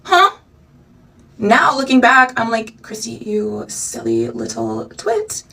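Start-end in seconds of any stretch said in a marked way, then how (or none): 2.8-5.4 much lower, slow and softer, a mock scolding of her younger self. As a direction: talk down to your past self, low and slow.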